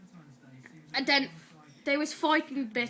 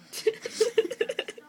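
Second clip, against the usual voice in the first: real high-pitched giggling fit; high giggle; 10 semitones higher than usual. Real, not acted. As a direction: break into helpless high giggles. Giggles in the middle of retelling.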